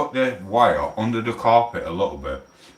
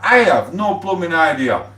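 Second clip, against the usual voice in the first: amazed exclaim; 9 semitones higher, 5 dB louder than usual. Real, not acted. Amazed at Vincent's size.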